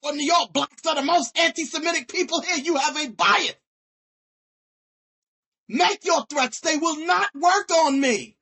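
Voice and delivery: loud, defiant and hot; angry outburst. Real, not acted. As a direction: fling the defiance at them. Furious rant at a religious group.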